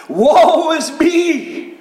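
high, drawn-out cry of woe. Acted. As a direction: wail it out slowly from the pulpit. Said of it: "He starts to cry out".

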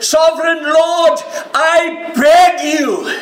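high, loud, slow begging; desperate high cry to the Lord; loud pleading. Acted. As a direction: plead with everything you have. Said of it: "He didn't say".

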